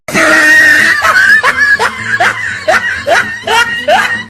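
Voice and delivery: crowd laughing and shouting; burst of laughter. Real, not acted. Audience bursts into laughter at the slipper joke.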